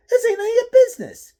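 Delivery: high, loud, fast angry snap. Acted. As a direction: rattle it off in a rage. Thug snaps at the stranger.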